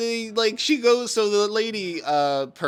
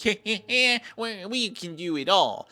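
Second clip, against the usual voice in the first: smug company; 7 semitones higher than usual. Retold or imitated. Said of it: "Monarch just thinks".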